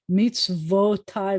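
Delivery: Mitzvotai said slowly with weight on -TAI; slow pronunciation. Shown as a demonstration. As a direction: lean on the ending of Mitzvotai. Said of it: "that ending means mine".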